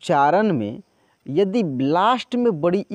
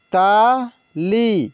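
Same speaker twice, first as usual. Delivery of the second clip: Tali drawn out very slowly, sound by sound; slow dictation of Tali; slow spelled-out reading; 3 dB louder, much slower than usual. Shown as a demonstration. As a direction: stretch Tali for the learners. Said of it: "teacher reads word slowly".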